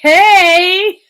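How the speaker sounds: a sudden high, loud "Hey!"; excited shout. Retold or imitated. Fan reacting to the radio host, loud high shout. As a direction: burst out with a loud, high yelp. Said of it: "I'm like".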